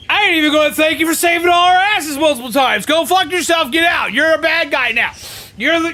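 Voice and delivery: hammed-up impression of a rude boss throwing him out; mocking the commander. Streamer mimicking the commander's eviction speech, mock yell. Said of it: "He's like".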